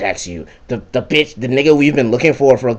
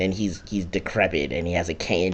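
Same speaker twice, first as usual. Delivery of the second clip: usual speaking voice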